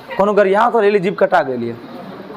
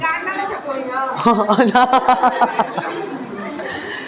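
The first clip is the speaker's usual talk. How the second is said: laughter; 5 dB quieter than usual. Real, not acted. Class laughs at joke.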